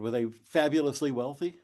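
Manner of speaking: ordinary talk, nothing marked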